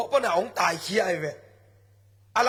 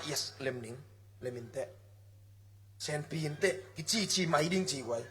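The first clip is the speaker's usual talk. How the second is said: quiet preaching; 6 semitones lower, 9 dB quieter than usual. Acted. Preacher drops to near hush.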